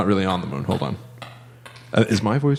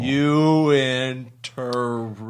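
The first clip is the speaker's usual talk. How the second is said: extremely slow, dragged-out words; really slow; much slower than usual. Shown as a demonstration. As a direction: stretch every syllable out. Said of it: "let's talk really slow".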